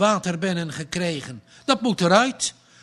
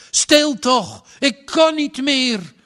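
much higher, louder desperate cry; desperate shout; 9 semitones higher, 6 dB louder than usual. A narrator's reading. Skipper slapping the panicking boy, desperate shout. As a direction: snap him out of it with a yell.

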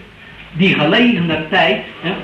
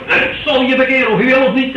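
higher, pushier voice for a forcing God; mock forcing God; 6 semitones higher than usual. Preacher mocks a God who forces conversion, higher and pushy. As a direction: play a God who bullies you into faith. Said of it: "hè, maar niet zo".

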